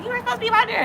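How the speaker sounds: high-pitched mock yell, no real volume; retold telling-off in a high voice; imitated scolding. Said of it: "she was yelling at her".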